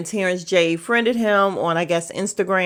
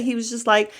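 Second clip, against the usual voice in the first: ordinary talk, nothing marked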